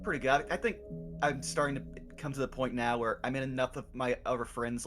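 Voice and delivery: ordinary talk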